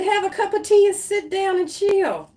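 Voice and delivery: high-pitched, insistent playful instructions; voice of the universe. Acted. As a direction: call it out high, like cheerful orders from above. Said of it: "Universe said".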